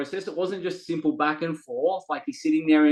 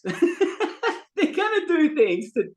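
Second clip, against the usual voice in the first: laughing; 10 semitones higher, much slower than usual. Real, not acted. Laughs about kids testing parents.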